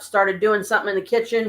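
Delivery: ordinary talk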